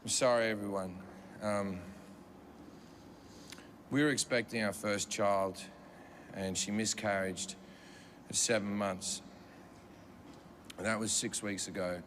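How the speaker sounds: somber apology. Retold or imitated. Husband apologizing to the comic for his crying wife, low and grave. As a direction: apologize heavily, low and sorry. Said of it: "and he goes".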